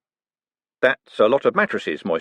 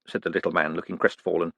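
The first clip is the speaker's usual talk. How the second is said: ordinary delivery, nothing marked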